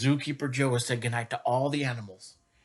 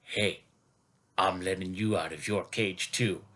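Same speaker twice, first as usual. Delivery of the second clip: gorilla voice; 8 semitones lower than usual. Pretend. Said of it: "But Gorilla says".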